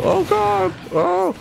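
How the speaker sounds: high, sudden panicked cry; startled yelp. Real, not acted. Gamer yelping at a near knockout, high panic. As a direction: yelp as your fighter nearly dies.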